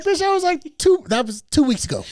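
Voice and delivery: retelling an exasperated comeback. Retold or imitated. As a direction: fire it back, incredulous. Retold exasperated reply.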